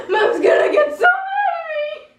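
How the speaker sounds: high-pitched crying wail with laughter; mock sobbing. Child sobbing under the bed, mock sobbing. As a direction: wail it like a hammed-up crying kid. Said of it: "and I'm sobbing".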